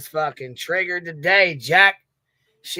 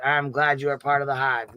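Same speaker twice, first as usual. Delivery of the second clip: ordinary talk, nothing marked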